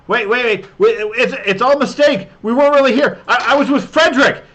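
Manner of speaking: frantic high-pitched shouted plea; Carlton calling out. Acted. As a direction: yell excuses down a hallway, stammering. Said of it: "calling out".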